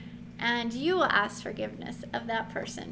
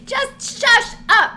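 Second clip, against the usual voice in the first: higher and louder, slow, laughing-edged; shush up; 11 semitones higher, 12 dB louder, much slower than usual. Real, not acted. Teacher telling over-explainers to stop, higher, louder and slow. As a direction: cut them off with a loud, slow shush.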